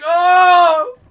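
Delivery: excited shout. Retold or imitated. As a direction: yell out in thrilled surprise. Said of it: "And I'm like".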